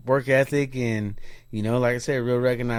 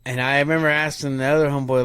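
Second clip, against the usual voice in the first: ordinary delivery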